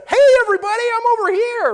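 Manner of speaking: very high, very loud holler; attention-grabbing shout. Acted. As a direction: call out to the whole crowd. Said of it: "I'm not like a".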